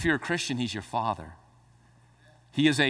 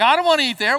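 picky eater; 10 semitones higher, 7 dB louder, faster than usual. Retold or imitated. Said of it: "they're like".

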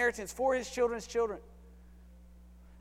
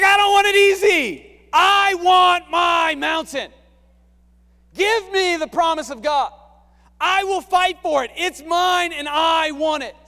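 high, loud, slowing on key lines; defiant shout; 7 semitones higher, 10 dB louder, slower than usual. Retold or imitated. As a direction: claim the mountain fiercely. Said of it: "He's like".

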